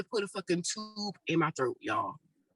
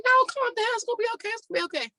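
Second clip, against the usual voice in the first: high, soothing calm-down voice, louder at first; soothing nurse; 13 semitones higher, 3 dB louder, much faster than usual. Retold or imitated. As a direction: imitate a nurse calming a panicked patient. Staff calming crying patient.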